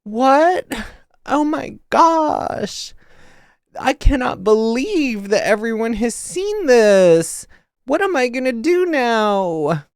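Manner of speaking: high, slow, helpless mock gasp; meek shocked girl. Mocking the daughter's meek reaction, high and slow. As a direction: play her as timid and shocked. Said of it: "that's the energy".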